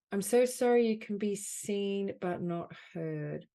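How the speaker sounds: projected, emphatic apology phrases with long pauses; guided healing affirmation. Acted. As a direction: offer each phrase firmly to be felt.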